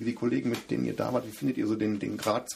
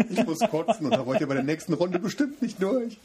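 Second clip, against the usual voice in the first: laughing through a retold gripe; half-laughing, higher and louder; laughing mock complaint; 8 semitones higher, 6 dB louder than usual. Playing a nauseous runner. (lachend gespielter Läufer)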